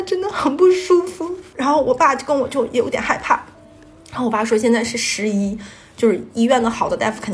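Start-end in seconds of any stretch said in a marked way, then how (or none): 0.0-1.3 voice breaking. Real, not acted. Recalling mother's illness, voice breaks.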